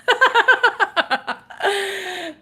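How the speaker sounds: high, loud burst of laughter. Real, not acted. Laughs after own taunt.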